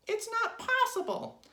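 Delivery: very high, loud squawk; Mrs. Twit's protest. A narrator's reading. Wife refusing to believe husband, high loud cry. Wife denying she is shrinking.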